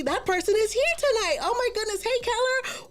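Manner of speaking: light up greeting a newcomer; excited welcome. Real, not acted. Host welcoming a new viewer, excited high greeting. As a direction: pitch jumps up high for the welcome.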